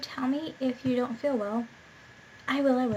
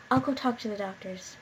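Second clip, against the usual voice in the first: usual speaking voice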